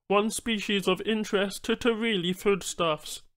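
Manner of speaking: high, odd-timbred alien voice; alien Banu trader. Acted.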